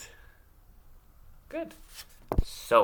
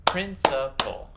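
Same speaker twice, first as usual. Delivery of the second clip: PRINCIPAL louder, beat out syllable by syllable; clapped syllables; 6 dB louder than usual. Shown as a demonstration. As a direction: punch each syllable of "principal" separately. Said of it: "clap them out".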